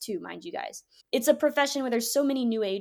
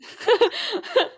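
laugh; 10 semitones higher than usual. Real, not acted. Laughs while voicing God.

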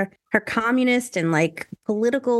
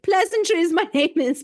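mock posh greeting; 7 semitones higher than usual. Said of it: "you can't be like".

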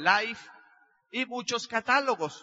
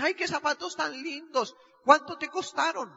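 higher, put-on friend voice; admiring friend; 5 semitones higher than usual. Friend admiring his new shoes and asking the price, lightly voiced. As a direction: gush over the shoes, then pry.

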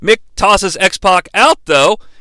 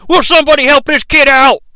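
high, loud imitated plea; J R pleading; 7 semitones higher, 5 dB louder, faster than usual. Announcer pleading with the locker room, urgent high shout. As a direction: plead loudly for help, pitched up. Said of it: "J R pleads with everyone".

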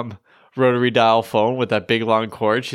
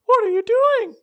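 much higher, squeaky attempt at an English accent; failed English accent. Shown as a demonstration. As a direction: try an English accent and miss badly. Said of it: "I was trying to do an English accent".

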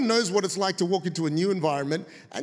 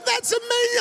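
hammed-up outburst. Retold outburst in phone quarrel.

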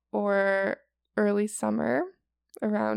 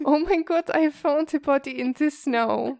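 put-on higher, louder German accent; hammed-up German hiker; mock German accent; 5 semitones higher, 3 dB louder than usual. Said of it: "I'm so sorry to all Germans".

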